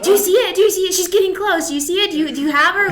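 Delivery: high, loud, eager urging; excited hype girl. Retold or imitated. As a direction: cheer her on in a rush. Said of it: "my hype girl in the back of the blind".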